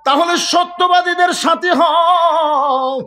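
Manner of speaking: high, drawn-out, chanted cry; preaching exhortation. Real, not acted. Preacher urging listeners to side with the truthful, chanted exhortation. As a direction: ring it out slowly, stretching the last word.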